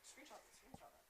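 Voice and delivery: urgent off-air whisper; hushed aside. Real, not acted. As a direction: whisper it so the mic misses it. Off-air aside to co-host during broadcast.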